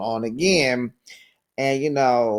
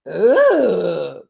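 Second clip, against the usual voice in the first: disgusted ugh; 6 dB louder than usual. Retold or imitated.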